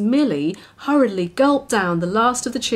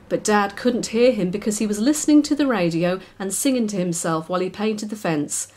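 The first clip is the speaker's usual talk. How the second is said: ordinary delivery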